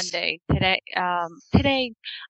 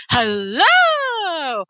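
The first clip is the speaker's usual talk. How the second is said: higher, louder drawn-out hello from the recording; fake pickup hello; 8 semitones higher, 5 dB louder than usual. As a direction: sound like she just answered live. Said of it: "you hear her going".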